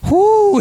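sudden loud high whoop of delight; excited whoo. Real, not acted. Pastor whooping at the couple's story, loud and high. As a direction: hoot as if the story thrills you.

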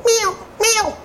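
high, dainty mock meow; mock kitten meow to mock the enemy; tiny cat meow. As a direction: squeak it like a little kitten. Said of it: "my little tiny cat".

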